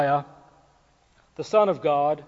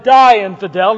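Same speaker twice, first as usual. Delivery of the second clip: loud mock battle cry; hammed-up fanatic shout; 6 semitones higher, 14 dB louder than usual. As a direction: bellow it like a zealot attacking. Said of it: "And Emo shouted".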